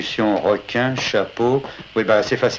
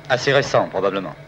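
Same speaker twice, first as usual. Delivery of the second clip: usual speaking voice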